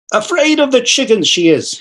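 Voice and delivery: put-on high teasing baby talk to the cat; mock-scolding the cat, pitched high. A narrator's reading. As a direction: tease the cat playfully. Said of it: "he said mockingly".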